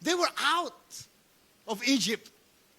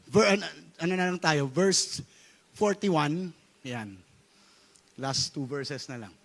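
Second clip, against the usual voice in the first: normal talking voice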